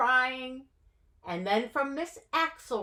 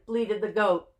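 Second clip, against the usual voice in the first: plain reading voice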